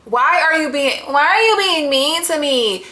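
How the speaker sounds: mock the whiny complaint, stretching it out; exaggerated, incredulous mocking quote; mocking repeat. Mocks the quote she just read.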